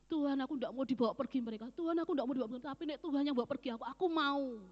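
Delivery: loud, rushed, frantic plea; desperate pleading. Retold or imitated. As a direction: beg God not to be taken away. Desperate plea to God retold.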